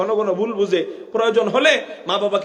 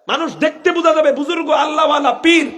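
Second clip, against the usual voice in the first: raised mocking call; 7 semitones higher than usual. Preacher mocking pious-looking hypocrites.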